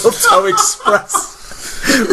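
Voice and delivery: high, loud, helpless laughter; drawn-out words through laughter; real laughing repeat. Real, not acted. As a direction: crack up while echoing the punchline. Cracking up at the other's joke.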